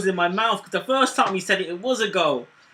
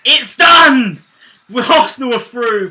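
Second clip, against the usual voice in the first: loud, joyous shout; jubilant shout; 5 semitones higher, 12 dB louder than usual. Real, not acted. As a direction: celebrate the win at full volume. Final whistle, team goes through.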